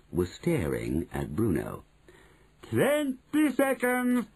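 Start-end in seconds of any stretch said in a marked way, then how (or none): 2.6-4.2 high, long-drawn countdown call; very slow, high-pitched witch announcement; Grand High Witch crying out. A narrator's reading. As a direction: stretch the words out in a witchy cry. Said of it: "cried the Grand High Witch".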